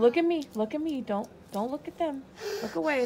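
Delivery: urgent firm command. Real, not acted. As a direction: grab her attention fast. Mother redirecting the child during the needle.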